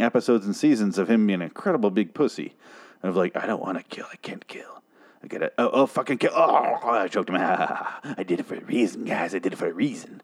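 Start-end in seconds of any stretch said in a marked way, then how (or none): 3.4-10.2 whispery, strained mock agonising; soft, mock-tormented impression; mocking whimper. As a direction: play a reluctant killer, hammed-up and breaking into whisper. Mocks reluctant killer Morgan.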